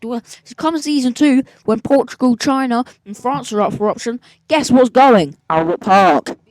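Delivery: rising into a loud, fed-up rant; angry rant. Real, not acted. Driver raging at Albert Park being dropped, loud angry rant. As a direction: shout it out like a grudge.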